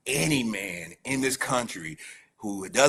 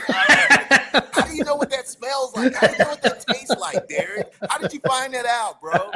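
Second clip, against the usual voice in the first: high, loud laughing mock questions; ribbing a buddy while cracking up; teasing through laughter; 12 semitones higher, 8 dB louder than usual. Real, not acted. Laughing, mocking his own quote.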